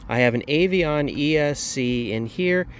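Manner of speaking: normal talking voice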